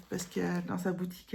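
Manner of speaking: ordinary delivery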